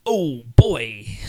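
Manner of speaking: a mock old New Yorker, whispered; bad New York accent. Imitating the audiobook narrator's bad New York accent. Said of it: "they all talk like this".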